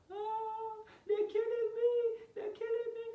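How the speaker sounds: very high, loud hammed-up wail; exaggerated high-pitched sobbing plea; mock wailing cry. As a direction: imitate an aunt crying out for help. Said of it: "she starts crying".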